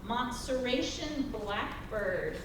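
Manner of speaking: Montserratian blackbird said slowly, syllable by syllable; careful pronunciation. Shown as a demonstration. Host reading a bird's name to the audience, careful. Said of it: "I hope I say this correctly".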